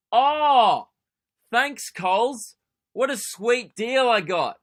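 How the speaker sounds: sarcastic. Real, not acted. Sarcastic thanks over fake sale.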